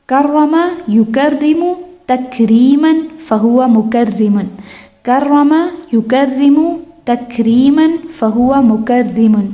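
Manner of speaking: measured, deliberate Arabic recitation; slow drill recitation. Shown as a demonstration. Teacher models a verb family for students, slow recitation. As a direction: recite the word family slowly for memorizing.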